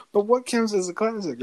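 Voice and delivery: high, whiny mock of his question; mocking whine. Friend mocking his dodge of the question, high whiny mimic. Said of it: "and he's like".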